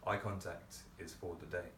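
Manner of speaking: normal talking voice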